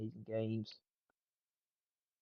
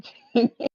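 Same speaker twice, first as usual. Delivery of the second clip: sudden shrieking laugh, much higher and louder; 12 semitones higher, 14 dB louder than usual. Real, not acted. Streamer cracks up at a joke, shrieking laugh. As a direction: let a big laugh burst out.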